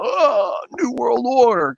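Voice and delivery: high, brash wrestling promo voice; wrestler impression. Mocking a wrestler's macho promo, hammed-up. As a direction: ham up a macho wrestler, lightly. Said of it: "God, I suck with my impressions".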